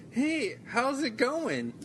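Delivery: high, very slow, overly sweet hello; forced friendly greeting. Retold or imitated. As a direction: fake a cheery hi through annoyance. Mock-polite greeting to the kid.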